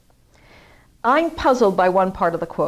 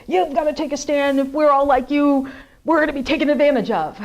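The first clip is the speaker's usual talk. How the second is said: quoting critics; 6 semitones higher, 4 dB louder than usual. Retold or imitated. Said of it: "there are some people who".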